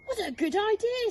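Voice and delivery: high eager Zog voice; grateful little dragon; eager young dragon. Acted. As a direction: brighten up at the idea. Zog answering the girl.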